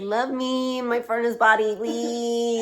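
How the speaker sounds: high whiny plea; pleading. Retold or imitated. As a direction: whine for a cuddle. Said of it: "I was like".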